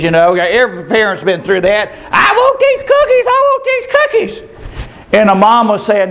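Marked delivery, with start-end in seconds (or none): 2.1-4.6 put-on very high-pitched tantrum whine of a small child; imitated child's high wail for treats; screaming little girl. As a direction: mimic a demanding preschooler in a squeaky high voice. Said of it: "that little girl was screaming".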